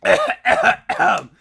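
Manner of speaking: sudden loud hacking cough; coughing fit. Real, not acted. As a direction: cough hard, water down the wrong pipe. Chokes on water mid-show.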